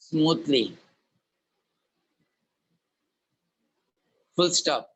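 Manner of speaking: slow, clear dictation with long pauses; dictating. Acted. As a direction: dictate each word slowly for note-taking. Teacher dictates the problem statement.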